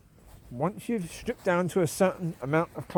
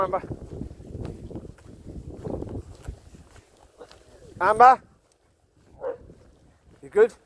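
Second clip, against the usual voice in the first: calling the dog; 4 semitones higher, faster than usual. Real, not acted. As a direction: call her in like a dog owner on a walk.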